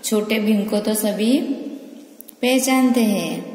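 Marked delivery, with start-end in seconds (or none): none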